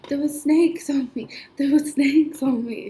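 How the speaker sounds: high, loud panicked blurt. Retold or imitated. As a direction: repeat it like you just woke from a nightmare. Retelling waking from snake nightmare.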